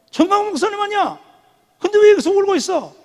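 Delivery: very high-pitched astonished questions; acted high voice of surprise; surprised wife. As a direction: imitate a startled older pastor's wife. Pastor's wife finds him crying.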